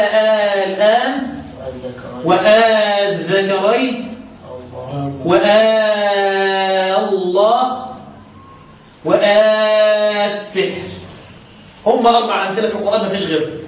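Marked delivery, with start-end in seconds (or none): 0.0-10.7 آل آن, وآل ذكرين, وآل الله, وآل السحر with the long madd drawn out slowly; Quranic recitation. Shown as a demonstration. Teacher demonstrating the madd in آل آن for students, slowly drawn out. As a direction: stretch the long vowel in آل آن and وآل الله in tajweed style. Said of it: "زي قوله تعالى".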